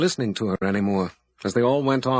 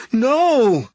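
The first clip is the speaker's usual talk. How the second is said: high, loud anguished scream; 12 semitones higher, 9 dB louder than usual. A narrator's reading. Abby screams at the wizard turning away. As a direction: scream it at him as he turns. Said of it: "Abby screamed".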